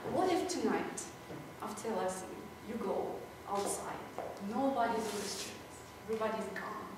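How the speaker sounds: soft, low storytelling with pauses; hushed scene-setting. Retold or imitated. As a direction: draw the student quietly into an imagined scene. Teacher sets imaginary scene for student.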